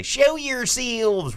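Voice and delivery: very high, stretched mock Southern; hammed-up, drawn-out Southern movie cry; bad Southern accent. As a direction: holler it in a ridiculous Southern drawl. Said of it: "when we say".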